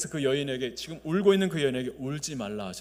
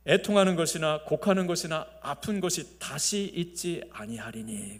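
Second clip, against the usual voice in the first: proclaimed scripture; 5 semitones higher, 8 dB louder than usual. Acted. Pastor proclaiming the voice from heaven to the church, loud and ringing. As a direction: proclaim the great voice from the throne. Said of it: "자, 들리는 소리가".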